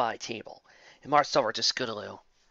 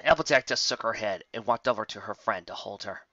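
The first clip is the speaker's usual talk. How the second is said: plain reading voice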